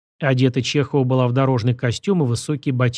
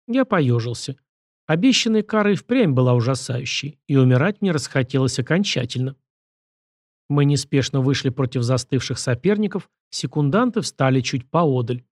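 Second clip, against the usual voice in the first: usual speaking voice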